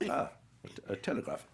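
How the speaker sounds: lightly acted stammer on reading; Anthony Hopkins. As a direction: stumble slightly, then say it evenly. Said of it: "And he goes".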